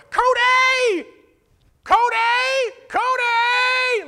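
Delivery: full-force high, drawn-out holler; yelling. Retold or imitated. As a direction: imitate a frantic woman yelling a name down the street. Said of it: "like, yelling at the top of her lungs".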